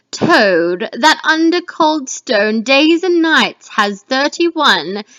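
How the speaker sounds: loud, slow, menacing witch incantation; third witch. Acted. Teacher reading the third witch to the class, loud slow chant. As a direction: chant the recipe over the pot. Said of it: "This is the third witch".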